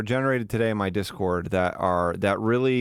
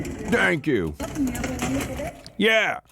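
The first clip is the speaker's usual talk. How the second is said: mocking echo; 12 semitones higher, much slower than usual. Echoing the video host.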